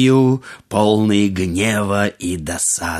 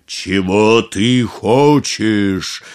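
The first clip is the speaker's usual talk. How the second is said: booming thunder and wind; 2 semitones lower, much slower than usual. A narrator's reading. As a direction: rumble it like distant thunder. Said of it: "спросил джин голосом, подобным грому".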